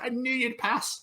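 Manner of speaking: high, sing-song smug teacher; mock smug teacher. Teacher claiming credit for Tom's pass, high mock smug. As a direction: chirp it smugly like the teacher. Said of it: "And she was like".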